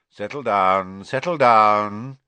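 loud, drawn-out idle command. A narrator's reading. Professor settling a potions class, idly. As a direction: call for order idly, stretching it out. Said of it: "said Professor Snape idly".

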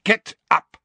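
loud, high sharp order; sharp command. A narrator's reading. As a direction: bark it out sharply. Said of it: "said Snape sharply".

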